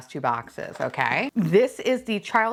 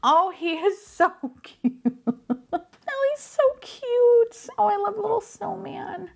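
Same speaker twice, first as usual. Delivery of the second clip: much higher, slow adoring coo. Real, not acted. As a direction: melt over the cute snowman. Cooing over cute figure.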